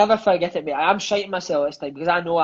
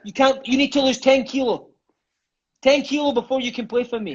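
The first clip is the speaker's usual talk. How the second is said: stern coach; 6 semitones higher than usual. Retold or imitated. Coach quoted setting weight target.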